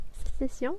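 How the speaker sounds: "Sécession" said the French way, rising in surprise; French "Sécession" as a question; French pronunciation. Shown as a demonstration. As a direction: query "Sécession" as "say-seh-syon". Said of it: "say it in French".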